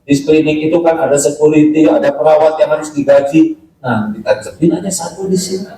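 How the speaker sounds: ordinary talk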